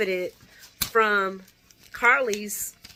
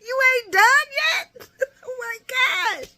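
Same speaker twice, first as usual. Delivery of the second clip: laughing disbelief; 4 dB louder than usual. Retold or imitated. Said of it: "I was like".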